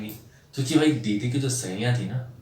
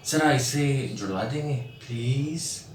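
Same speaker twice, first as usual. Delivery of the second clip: sweet pleading request; 2 semitones higher, slower than usual. Retold or imitated. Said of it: "उनका जो please था ना".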